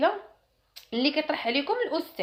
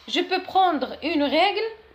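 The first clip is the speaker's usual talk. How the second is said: slow, higher polite request; slow model question; 7 semitones higher, 5 dB louder, much slower than usual. Shown as a demonstration. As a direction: ask for a ruler slowly and higher. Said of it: "model phrase". (سؤال نموذجي ببطء وبصوت أعلى)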